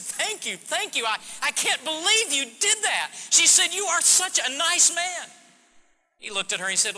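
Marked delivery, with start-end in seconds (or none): 0.0-5.3 high, overflowing thanks, slowing at the end; grateful gushing. Retold or imitated. Preacher voicing the relieved mother thanking the stranger, high and gushing. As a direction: gush with relief and gratitude.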